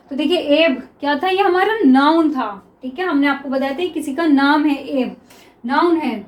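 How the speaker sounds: ordinary talk, nothing marked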